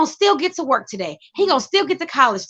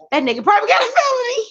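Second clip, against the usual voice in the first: mock suspicious onlooker; 10 semitones higher, faster than usual. Voicing a judging onlooker.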